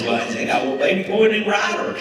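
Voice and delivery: high, loud Southern lady voice; one-up your friend in a hammed-up Southern accent; high-pitched Southern brag; bad Southern accent. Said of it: "Bad accent".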